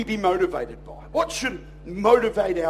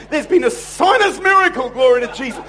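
loud, high mock proclamation; hammed-up praise report; jubilant; 4 semitones higher, 7 dB louder than usual. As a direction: exclaim it like a triumphant testimony. Voices a woman's jubilant church text.

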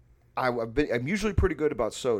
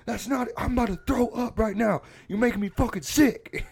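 high, loud mock disgust; imitated outraged rant; 13 semitones higher, 7 dB louder than usual. Roommate up from couch, sickened by milk, imitated yelling. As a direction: shout it like a revolted roommate. Roommate rants in retold quarrel.

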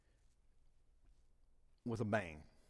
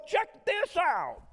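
slow, high mock show-off; mock selfie taker; 4 dB louder, much slower than usual. Pastor mimicking someone taking a selfie with God, slow mock show-off. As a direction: drawl it like a vain selfie.

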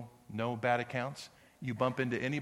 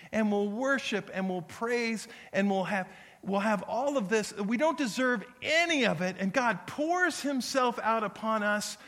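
rising, loud, high preacher's build; preaching at full force; 7 semitones higher, 5 dB louder than usual. Acted. Pastor building to a peak before the congregation, full-force preaching. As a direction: preach it harder with each line till it peaks.

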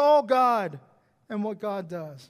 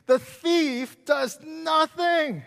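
slow emphasis; 7 semitones higher, 5 dB louder, much slower than usual. Real, not acted. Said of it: "emphatic slow preaching point".

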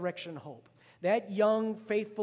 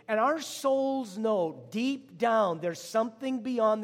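drawn out slowly and loud, each word weighted; stretched-out preached emphasis; slow emphatic preaching; 4 semitones higher, 5 dB louder, much slower than usual. Real, not acted. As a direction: press the words home slowly. Preacher stressing the soul's longing.

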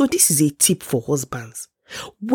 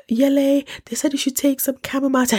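put-on bright, quick kid sister; high little sister voice; 10 semitones higher than usual. Little sister relaying advice to Yele, high and quick.

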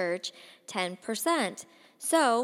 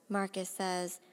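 ordinary delivery

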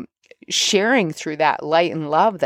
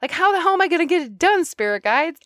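much higher, whiny pleading voice; exasperated plea to spirit guides; 10 semitones higher than usual. Retold or imitated. Talking to her spirit guides, high exasperated whine. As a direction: beg the spirit guides, frazzled. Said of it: "I said".

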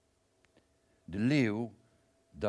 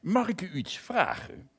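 high, louder voice of the lion; the lion asks politely in a raised voice; lion's voice; 12 semitones higher, 7 dB louder than usual. Acted. Lion politely asks the narrator a question, high and loud.

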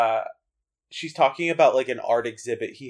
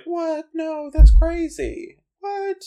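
mock shocked squeal; 3 dB louder, slower than usual. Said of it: "she's like".

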